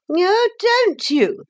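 very high, drawn-out retort; hateful lady. A narrator's reading. Motor lady answering Philip's objection, high and hateful. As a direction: stretch it high, mock-sweet.

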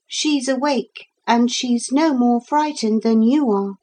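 put-on high-pitched girl's reply; girl character. A narrator's reading. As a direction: answer as a child, voice well up. Narrator voices the girl.

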